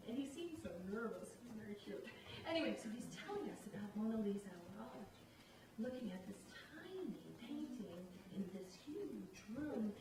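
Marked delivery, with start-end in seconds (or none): none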